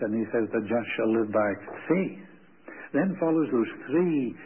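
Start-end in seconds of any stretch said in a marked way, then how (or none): 0.5-2.2 "faith" stressed, voice lifting on it. Shown as a demonstration. Preacher showing Hebrews' emphasis, "faith" stressed. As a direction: land hard on "faith" at the end. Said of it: "he puts the emphasis on the faith".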